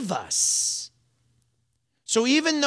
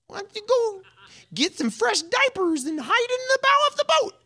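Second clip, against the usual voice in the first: high, mocking sneer at timid men; silly mocking Jesus voice; 10 semitones higher, 4 dB louder than usual. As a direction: tease them in a squeaky mocking voice. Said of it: "talking in silly voice and mocking them".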